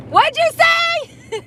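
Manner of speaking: high, drawn-out fake shout; playful high call over blaring music; mock shout over music. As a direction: pretend you can't hear over the radio. Pretends music drowns her out.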